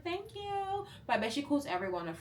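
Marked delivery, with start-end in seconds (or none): 0.0-0.8 a flustered high-pitched thank you; bashful little squeak of thanks; high squeaky thanks. Retold or imitated. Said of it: "I was like".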